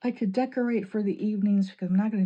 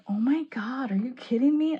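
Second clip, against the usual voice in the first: higher, louder mock disbelief; imitating disbelief; 6 semitones higher, 5 dB louder than usual. Fiancé hearing her TV rule, mildly mock incredulous. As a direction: play the stunned fiancé, a bit hammed up. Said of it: "he was just like".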